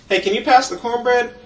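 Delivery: put-on high, loud, eager childhood request; younger self at table. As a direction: pipe up loudly with a high-pitched ask. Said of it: "that would go something like this".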